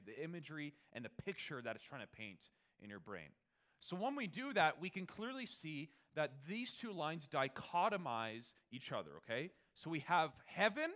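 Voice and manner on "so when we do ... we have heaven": louder, higher emphatic teaching; preacher building emphasis on heaven and glory; rising preaching. Acted. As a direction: build it up again.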